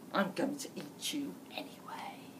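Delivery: put-on hushed threat to the girl; menacing whisper. A narrator's reading. Wolf threatens Red in a whisper. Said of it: "no matter what you say".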